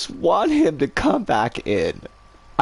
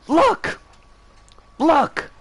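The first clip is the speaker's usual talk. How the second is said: pissed-off yell; 11 semitones higher than usual. Retold or imitated. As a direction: bark it out as the scowling customer, pitch up high. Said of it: "he's got this really pissed off voice".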